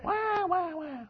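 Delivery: very high, soft "wah wah"; mock crying. Preacher mimicking Jacob crying over sons, mock crying. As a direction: fake a whiny baby's cry. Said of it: "He cried".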